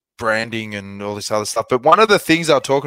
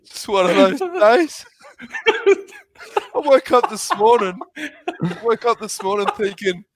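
laughing; 10 semitones higher than usual. Real, not acted. Laughing through his story.

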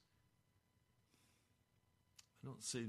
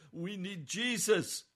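much louder, higher, slow cry of need; impassioned shout; 7 semitones higher, 10 dB louder, slower than usual. Real, not acted. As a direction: shout it out slowly to the whole room. Preacher shouting to sister.

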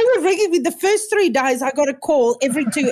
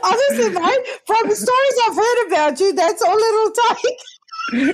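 teasing through laughter, higher; laughing; 7 semitones higher than usual. Real, not acted. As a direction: rib your mate while laughing. Laughing tease.

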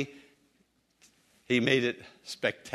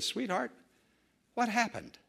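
tender husband; 9 semitones higher, 5 dB quieter than usual. Retold or imitated. Husband gently asks his wife.

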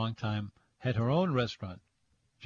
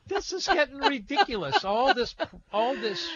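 real high-pitched laughing protest; 13 semitones higher, 3 dB louder than usual. Real, not acted. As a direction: laugh through the words, voice jumping up. Amused by caller's flattering story.

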